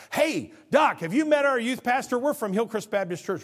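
high, loud, bright-eyed invitation; eager greeting. Retold or imitated. Sick church member eagerly introduces his youth pastor to the doctor, excited. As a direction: call out to the doctor with eager joy.

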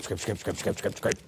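muttered hushed scrape scrape; hushed mock scraping noise; quiet scraping sound. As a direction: whisper the scrapes like sneaky kids. Imitating scraping broken dish.

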